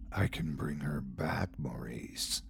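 breathy, menacing whisper; sinister whisper. A narrator's reading. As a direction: whisper it like a threat in the dark. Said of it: "Uncle Scratch's sinister voice".

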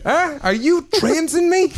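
hammed-up mock question. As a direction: overplay the baffled interviewer. Escalating the mock interrogation.

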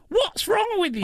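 high-pitched, laughing disbelief; mock appalled. Challenging people who hunt for bodies, mock appalled. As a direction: squeal it in comic outrage. Acted appalled reply to body hunters.

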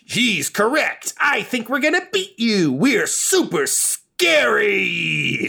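put-on high-pitched kid tag-team villain; squeaky boastful taunt, drawn out slowly at the end; Brazier. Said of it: "Brazier is like".

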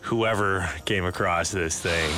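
low, slow spooky story voice; spooky storytelling. Acted. Tells a spooky story over music.

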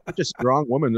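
normal talking voice

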